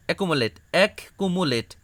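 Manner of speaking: অ্যাকুমুলেট said whole, then এক কুমুলেট in parts; whole word then split. Shown as a demonstration. Teacher modelling practice for students. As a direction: contrast the full word with its syllables. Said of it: "এভাবে তুমি বারবার practice করবা".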